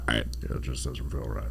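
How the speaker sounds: very low, very soft; low quiet movie voice. Retold or imitated. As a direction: murmur it deep and hushed.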